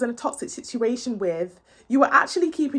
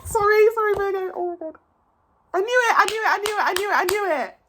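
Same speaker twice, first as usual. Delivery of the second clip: bursting with real delight, much higher; excited squeal; 8 semitones higher, faster than usual. Real, not acted. Tarot reader thrilled her prediction came true, high excited squeal.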